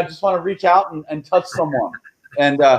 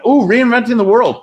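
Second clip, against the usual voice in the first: higher and louder, savoring a title; playful higher hype; hyped-up riff; 8 semitones higher, 5 dB louder than usual. Real, not acted. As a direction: announce it like a marquee. Guest riffs on a book title.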